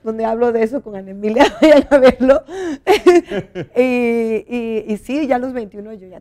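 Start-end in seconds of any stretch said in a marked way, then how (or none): 2.5-3.7 high bursting laugh; laughing at her own podcast plug; real laugh. Real, not acted. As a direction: break into a loud laugh.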